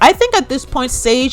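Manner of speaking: ordinary talk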